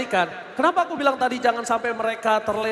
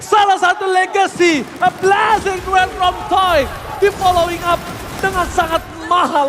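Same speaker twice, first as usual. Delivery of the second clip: loud and high, then settling; excited play-by-play; 5 semitones higher, 6 dB louder than usual. Real, not acted. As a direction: keep the hype, ease off. Play-by-play after the teamfight. (komentar heboh mereda)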